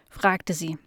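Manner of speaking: plain reading voice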